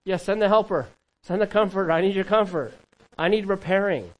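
imitating a desperate believer calling out; pleading prayer. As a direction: beg for the helper. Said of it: "It's like".

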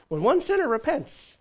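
the line lifted high with joy; excited. Real, not acted. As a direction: celebrate the repentance, pitch way up. Preacher repeats line with excitement.

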